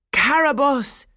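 loud, high exclamation of alarm; alarmed cry. A narrator's reading. King and vizier recognise the wicked fairy, crying out. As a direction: cry the name out in shock. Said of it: "cried together".

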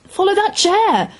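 high-pitched urgent yell; yelled command. A narrator's reading. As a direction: yell it high, as if sending a driver in pursuit. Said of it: "she yelled".

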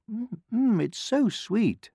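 high, drawn-out hum of delight; savouring. A narrator's reading. Courtiers tasting the sweet medicine, savouring it. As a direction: savour it slowly, pitched well up.

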